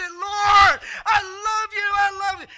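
loud, high cry of love to God; ecstatic worship cry. Retold or imitated. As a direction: cry it out like a fan in church. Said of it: "I'm so excited for Jesus".

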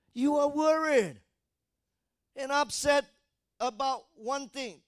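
high, loud, slow misquote stressing one thing; deliberate misquote. Shown as a demonstration. Preacher testing the congregation with a wrong quote, loud and slow. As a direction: say it wrong on purpose. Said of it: "No, you didn't hear properly".

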